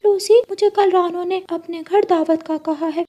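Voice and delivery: high, cheerful bird voice; parrot friend voice. Acted. Hariyali answering Rano's invitation, high cheerful voice. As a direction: chirp back eagerly.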